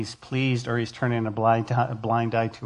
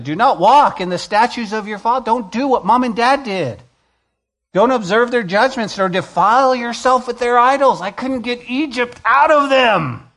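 raised, ringing command; fervent preacher voice; preaching at full force; 9 semitones higher, 4 dB louder than usual. Acted. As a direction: proclaim it loud and high.

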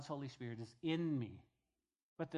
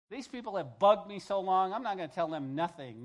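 louder, fed-up grumble; mock grumbling refusal; 2 semitones higher, 8 dB louder than usual. Preacher voicing a reluctant prophet, fed-up grumble. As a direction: play a prophet who's had enough.

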